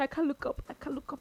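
softly, as if unable to face it; choked up. Retold or imitated. Retelling a tearful coach's interview, imitated choked-up voice. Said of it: "He put his head down".

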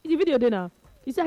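ordinary talk, nothing marked

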